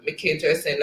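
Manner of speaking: ordinary talk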